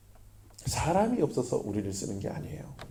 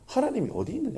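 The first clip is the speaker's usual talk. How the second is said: mocking question; 10 semitones higher than usual. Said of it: "이렇게".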